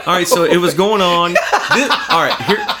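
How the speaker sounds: real laughter through the words, loud; giggling while you talk; breaking up with laughter; laughing. Real, not acted. Laughs while finding the post.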